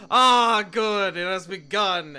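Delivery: loud, slow, dramatic dad; grand, loud proclamation; Pike. Acted. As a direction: boom it out with slow relish. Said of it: "and he goes".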